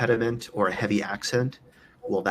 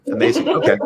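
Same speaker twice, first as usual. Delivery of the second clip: excited; 9 dB louder, much faster than usual. Real, not acted. Reacting after laughing at bad news.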